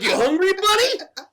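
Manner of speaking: high, loud coaxing; hammed-up eager question; eager pet-owner. Acting out feeding his pet Mogwai.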